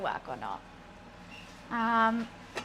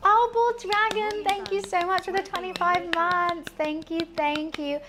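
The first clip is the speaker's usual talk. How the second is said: excited thanks; 7 semitones higher, 3 dB louder than usual. Real, not acted. Thanking a subscriber on stream.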